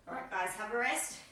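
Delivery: "All right, guys, have a rest" said panting after a set; winded, catching breath between words. Real, not acted. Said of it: "I'm out of breath".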